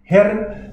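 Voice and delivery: Herren with a rolled R, lifted high. Shown as a demonstration. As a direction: roll the R in Herren. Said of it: "best to roll the R".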